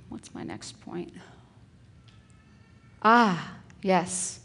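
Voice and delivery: ordinary delivery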